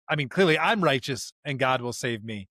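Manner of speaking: ordinary talk, nothing marked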